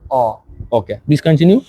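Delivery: usual speaking voice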